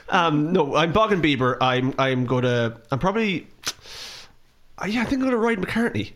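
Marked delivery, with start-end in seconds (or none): none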